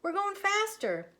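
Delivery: high, loud, alarmed; panicked yell. Acted. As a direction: scream as it speeds up. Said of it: "yelled Moo".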